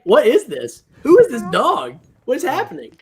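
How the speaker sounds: high-pitched mock-bewildered dog voice; playful rising alarm, pretending to be a dog; baffled dog. Voicing a dog puzzled by itself.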